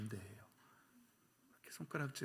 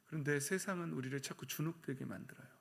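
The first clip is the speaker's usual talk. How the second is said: ordinary delivery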